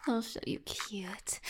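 threatening whisper; low, menacing whisper. Acted. Teasing partner, dominant roleplay.

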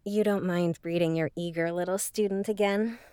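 spent afterglow. Acted. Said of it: "I can't trust my legs yet".

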